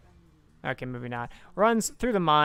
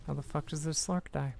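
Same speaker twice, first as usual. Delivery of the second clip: usual speaking voice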